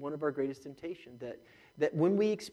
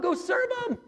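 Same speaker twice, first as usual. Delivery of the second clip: high ringing shouted command, higher and louder; Jesus commanding; 6 dB louder than usual. Acted. Jesus telling the church to serve, shouted high. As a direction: shout "serve" as an urgent order.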